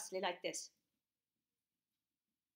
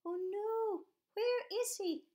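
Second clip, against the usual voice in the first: high, crying worried mum voice; 11 semitones higher, much slower than usual. Acted. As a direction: wail it like a frantic mother. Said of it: "looking very worried like this".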